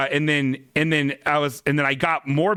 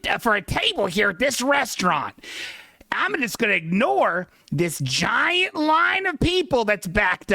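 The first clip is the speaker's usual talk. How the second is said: higher mock voice of a pushy lady; the line-cutting lady at the host stand, higher mock entitled voice; rude entitled lady; 8 semitones higher than usual. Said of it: "I'm a dumb lady who's wearing an animal print".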